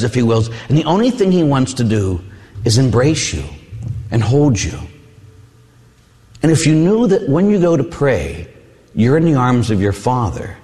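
ordinary talk

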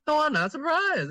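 high-pitched retold brush-off; mock dismissive. Re-voicing sister's unimpressed answer.

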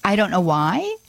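ordinary talk, nothing marked

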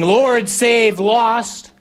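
high, slow, stretched-out holler; acted shout over a storm; yelled plea. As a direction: yell each word across the boat. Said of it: "one of us has yelled back to Jesus saying".

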